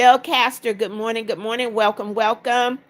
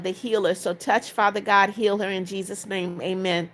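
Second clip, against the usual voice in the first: prayer; 2 semitones lower, 4 dB quieter than usual. Acted. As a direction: pray it quietly over the viewer. Prays for a viewer's sick mother.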